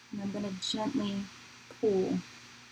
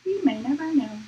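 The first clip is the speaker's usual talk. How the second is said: playful mysterious; 7 semitones higher, 5 dB louder than usual. Pretend. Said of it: "Why am I talking like this".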